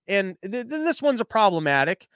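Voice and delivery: usual speaking voice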